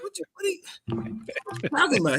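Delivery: a slow, high, bewildered whisper; whispered disbelief. Retold or imitated. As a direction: whisper it as if you can't believe the call. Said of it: "I'm like".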